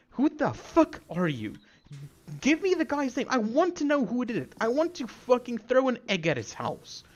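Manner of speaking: high, heated tirade; angry rant. Real, not acted. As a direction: lash out in outraged indignation. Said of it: "I did not expect to get this angry".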